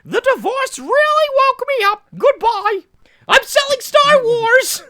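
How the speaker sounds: gleeful high falsetto announcement; Mickey Mouse. Pretend. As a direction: shout it like a giddy cartoon mouse. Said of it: "We should have done Mickey Mouse".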